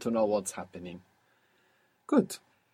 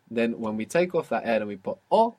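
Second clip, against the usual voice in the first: usual speaking voice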